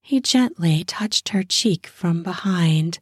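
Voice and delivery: plain reading voice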